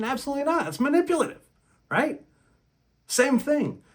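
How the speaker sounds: emphatic rant peak, incredulous; indignant high pitch. Real, not acted. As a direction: squeak it out in disbelief.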